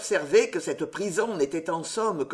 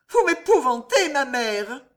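terrified cry; 7 semitones higher, 5 dB louder than usual. Acted. Daughter terrified by mother's words.

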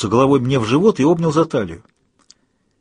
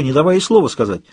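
normal talking voice

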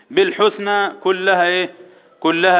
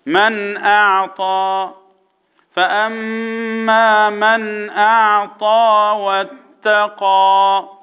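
very slow, sustained tajweed chant; Quran recitation; 3 semitones higher, much slower than usual. Shown as a demonstration. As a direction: intone the verse with long held vowels. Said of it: "قول وراي".

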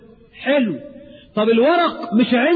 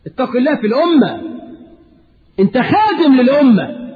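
louder, rising rebuke from the pulpit; fiery preaching; 4 semitones higher, 3 dB louder than usual. Acted. Preacher rebukes officials who waste the nation's time, fiery preaching. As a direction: thunder at the corrupt clerk.

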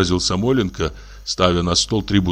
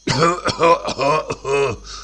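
a rough waking cough; 7 semitones higher than usual. A narrator's reading. Samoylenko wakes coughing at the knock, rough cough. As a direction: cough yourself awake. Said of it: "Послышался кашель".

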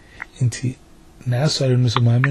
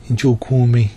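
ordinary talk

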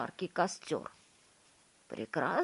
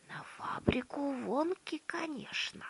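whisper; 9 semitones higher, 3 dB quieter than usual. A narrator's reading. Grandpa still whispering.

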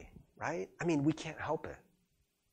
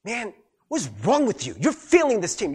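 higher-pitched mock scolding; lightly acted telling-off of a teammate; acted berating; 5 semitones higher, 4 dB louder than usual. Teammate to a player who keeps striking out, mock berating. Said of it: "They're not like".

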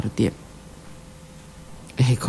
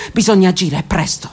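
ordinary talk, nothing marked

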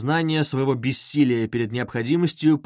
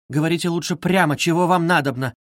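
raised, pained plea; anguished cry; 5 semitones higher, 4 dB louder than usual. A narrator's reading. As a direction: demand it with restrained suffering. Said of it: "вскричала с страданием Соня".

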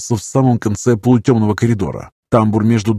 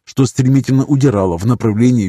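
plain reading voice

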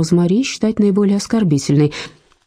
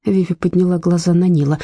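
plain reading voice

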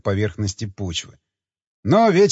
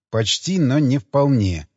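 ordinary delivery, nothing marked

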